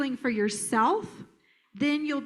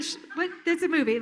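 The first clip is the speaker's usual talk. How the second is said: ordinary talk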